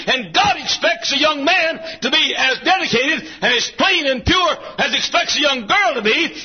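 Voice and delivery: full-force preaching, high and driving; rolling, high-pitched sermon cadence. Real, not acted.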